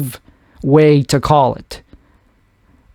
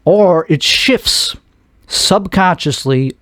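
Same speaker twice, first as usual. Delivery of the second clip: ordinary talk, nothing marked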